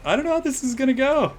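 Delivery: high mock-nervous squeal on know; squeaky repeat. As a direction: squeak it out like a panicked kid.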